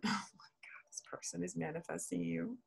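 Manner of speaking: hushed awed whisper. Real, not acted. Telling Gemini their person manifests them, in a whisper. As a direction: let it slip out as a secret. Awed aside about the Magician card.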